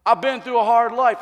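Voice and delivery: a hint of a defendant begging the judge; pleading murderer. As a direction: whine out a self-pitying excuse. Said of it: "he comes and said".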